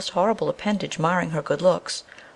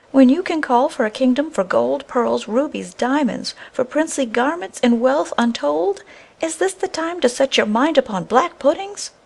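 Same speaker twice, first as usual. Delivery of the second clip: angry scolding; 8 semitones higher than usual. A narrator's reading. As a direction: tear into a foolish husband. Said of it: "in her anger and disappointment".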